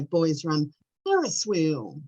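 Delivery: slow call with Ferris wheel drawn out; expressive call. Shown as a demonstration. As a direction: swoop through a stretched Ferris wheel. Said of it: "I'll do that sort of thing".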